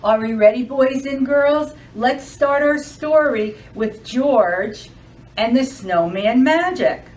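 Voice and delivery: ordinary talk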